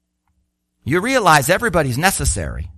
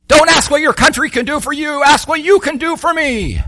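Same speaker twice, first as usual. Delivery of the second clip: higher, louder, brash mock Trump; 8 semitones higher, 5 dB louder than usual. Preacher mocking Trump twisting the famous line, brash. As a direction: ham up a boastful Trump demanding service. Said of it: "If Trump said that".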